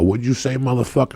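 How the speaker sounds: menacing challenge again. Retold or imitated. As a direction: snap the question back at him. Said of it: "I go".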